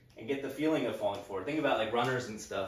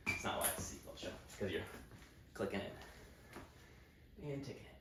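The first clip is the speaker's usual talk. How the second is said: whispered self-correction; muttered under breath; 3 semitones lower, 14 dB quieter than usual. Real, not acted. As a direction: mutter it quietly to yourself.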